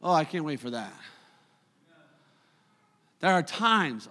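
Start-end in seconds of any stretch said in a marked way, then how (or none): none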